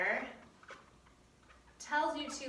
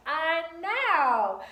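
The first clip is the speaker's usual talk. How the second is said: tag-team enthusiasm; 5 semitones higher, 6 dB louder than usual. Retold or imitated. Acts out her reply to students.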